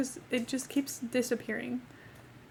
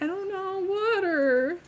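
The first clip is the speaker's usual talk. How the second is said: higher, helpless acted whine; mock helpless whine; 7 semitones higher, slower than usual. Embarrassed customer trying to order water, mock helpless. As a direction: plead it as a hammed-up wail.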